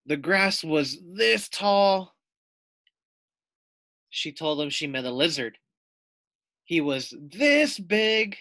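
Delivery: exaggerating child. [name] tells her family about her big adventure, exaggerating. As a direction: stretch it out to impress.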